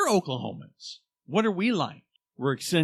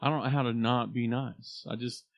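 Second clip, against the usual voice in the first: ordinary talk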